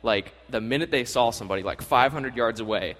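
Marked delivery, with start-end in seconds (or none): none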